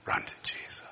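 soft urgent whisper. Real, not acted. Preacher urging addicts to run, whispered. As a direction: breathe it out intimately.

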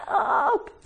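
high, thin, not-quite-human wail; eerie cry for help. Acted. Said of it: "doesn't really sound like a human".

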